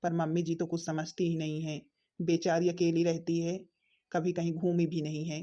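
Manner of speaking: muttered aside. Acted. Son's muttered aside about mother.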